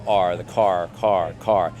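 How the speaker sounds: "car" with a hard American R. Shown as a demonstration. Australian actor demonstrates the tiring mouth shape, American R in "car". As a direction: sound the R fully in "R" and "car". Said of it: "it can get tiring just to do it".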